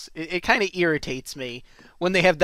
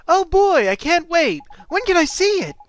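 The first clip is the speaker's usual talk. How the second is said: put-on high, loud, eager kid voice; thrilled cartoon youngster; excited kid Oliver; 14 semitones higher, 8 dB louder than usual. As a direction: burst out like a kid who can't wait.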